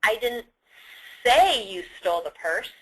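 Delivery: "say" stressed, implying you only thought it. Shown as a demonstration. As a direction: hold back, then hit "say" hard. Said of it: "When you say the third one".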